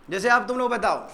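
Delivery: normal talking voice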